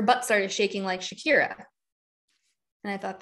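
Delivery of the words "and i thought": normal talking voice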